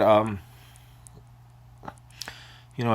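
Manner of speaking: normal talking voice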